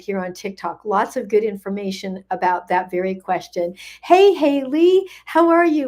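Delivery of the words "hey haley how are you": delighted greeting. Real, not acted. Host calls out again to Haley in chat, high and thrilled. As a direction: call out to her like a dear friend.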